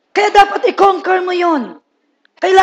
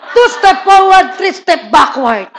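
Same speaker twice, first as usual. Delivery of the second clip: slow emphatic punchline; 3 semitones higher, 4 dB louder, slower than usual. Acted. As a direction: land the saying slowly for effect. Preacher's punchline, slowed.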